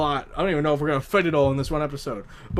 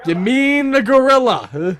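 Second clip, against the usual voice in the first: higher, louder, drawn-out challenge; insistent correction; 5 semitones higher, 5 dB louder, slower than usual. Real, not acted. Host correcting co-host on the animal, insistent correction. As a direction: stretch it out pointedly, louder and higher.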